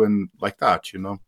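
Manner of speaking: ordinary talk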